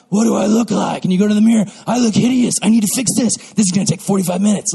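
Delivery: hammed-up vain panic; mock mirror panic. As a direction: blurt it out in exaggerated dismay at your looks. Mimicking panicked morning mirror check.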